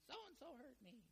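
put-on high, soft childish whimper; mock-tearful little child tattling; whiny crying kid. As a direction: play a kid whining that he got hurt. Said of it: "Kid comes in crying".

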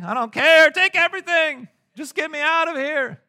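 high, loud "just take it all" cry; exasperated buyer caving at the dealership, high and loud; fed-up outburst. Retold or imitated. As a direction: throw up your hands and blurt it out loud. Exasperated car buyer giving up.

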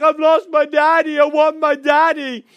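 put-on high, loud wail of a lost child; mock screaming cry. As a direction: bawl it out like a little boy. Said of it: "so I started screaming".

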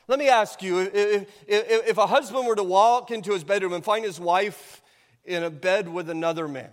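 fiery sermon voice, hammering each phrase; preaching at full force. Acted. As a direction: preach it like a warning from the pulpit.

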